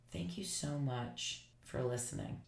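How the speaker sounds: quiet, soft thanks; soft gratitude. Retold or imitated. The woman thanking the listener, hushed and grateful. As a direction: thank her gently.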